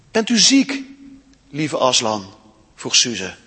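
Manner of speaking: expressive read-aloud storytelling; story reading. A narrator's reading. Storyteller reading Narnia to children in church, expressive.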